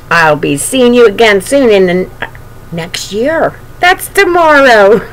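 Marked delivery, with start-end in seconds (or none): none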